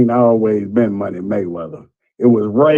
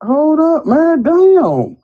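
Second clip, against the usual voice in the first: excited; 12 semitones higher than usual. Real, not acted. Caller reacts indignantly.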